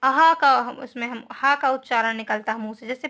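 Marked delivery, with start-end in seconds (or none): 0.0-0.8 अहा said loud with a strong breathy हा; teacher showing the visarga sound; breathy ha demo. Shown as a demonstration. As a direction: push out the हा in अहा. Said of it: "हा के उच्चारण में".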